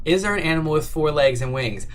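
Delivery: ordinary talk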